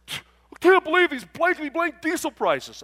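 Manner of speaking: loud, high, slow mock rant; imitated angry shout with censored swearing; fuming. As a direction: rage like an irate trucker, hammed up. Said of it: "he is fuming".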